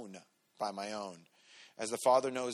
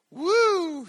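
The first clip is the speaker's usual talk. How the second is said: high, loud cheer of delight; happy woo; 12 dB louder than usual. Retold or imitated. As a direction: whoop like a thrilled kid. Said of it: "She's all happy".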